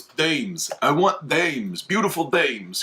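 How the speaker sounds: mock greedy gangster demand; hammed-up gangster. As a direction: stretch it out like an old movie mobster. Voices the gangster demanding women.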